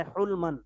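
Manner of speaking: حلمن said "hulman", with a short u; u after the H. Shown as a demonstration. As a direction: say حلمن with damma on the ح. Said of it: "ہا کے ضمے کے ساتھ". (حلمن کو حا کے پیش سے "حُلمن" پڑھیں)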